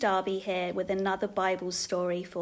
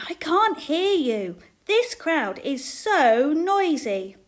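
high, loud, drawn-out words; calling over noise; 7 semitones higher, 8 dB louder, slower than usual. A narrator's reading. Father in a noisy crowd, calling loudly. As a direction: shout slowly over a crowd.

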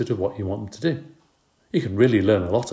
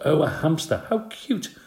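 high delighted coo; 7 semitones higher than usual. A narrator's reading. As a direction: coo over a cute pet. Babysitter coos at hamster.

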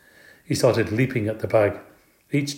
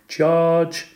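high charging yell; yelled battle cry; 6 semitones higher, 4 dB louder than usual. A narrator's reading. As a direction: yell it like leading an attack.

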